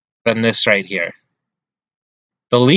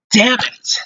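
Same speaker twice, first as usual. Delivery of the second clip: high, sharp curse at the miss; frustrated curse; 11 semitones higher than usual. Real, not acted. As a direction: spit out the disappointment. Goal chance missed.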